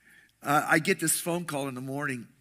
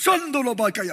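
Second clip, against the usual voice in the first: speaking in tongues; 6 semitones higher, 5 dB louder than usual. Retold or imitated. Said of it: "she just goes".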